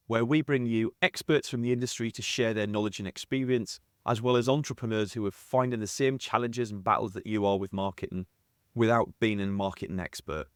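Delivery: ordinary talk, nothing marked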